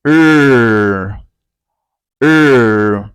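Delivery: loud, sustained 'Er' with the tongue rolled back. Shown as a demonstration. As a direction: roll the tongue and hold 'Er'. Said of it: "you have to roll your tongue".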